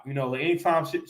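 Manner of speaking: ordinary delivery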